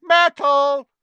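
very high, drawn-out loud yell; long piercing holler; bellow. Acted. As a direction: bellow across the room to summon someone. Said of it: "took a deep breath and bellowed".

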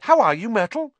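high, loud fake cheer; forced chirpy hello; falsely bright. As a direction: greet with overdone brightness. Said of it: "in a falsely bright voice".

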